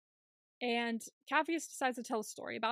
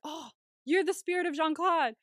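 higher, louder starstruck gush; raised admiring gush; 6 semitones higher, 6 dB louder than usual. Retold or imitated. Capheus gushing at Sun, higher and louder. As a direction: gush like a fan.

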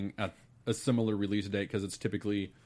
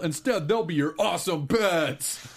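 big booming hype announcer, higher; monster truck ad; 7 semitones higher, 4 dB louder than usual. Acted. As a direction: sell it like a monster truck rally. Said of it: "announcing a monster truck rally".

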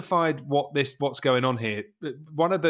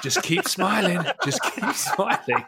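echoing in his head; 5 semitones higher than usual. Retold or imitated. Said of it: "replayed like it's in his head".

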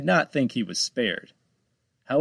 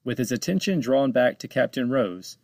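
ordinary delivery, nothing marked

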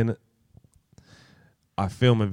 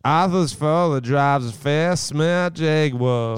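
put-on slow, over-the-top American accent, a touch higher; hammed-up American; 4 semitones higher, slower than usual. Mate proudly claims to be from America, hammed-up American. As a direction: ham up an American voice. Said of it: "Hey, I'm from America".

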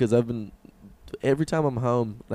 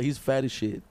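usual speaking voice

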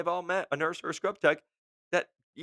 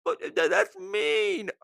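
much higher, slow, pouty whine; whiny crybaby; slower than usual. Retold or imitated. Mocking a rep whining that the boss was mean. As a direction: whimper it like a hurt child. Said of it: "he yelled at me".

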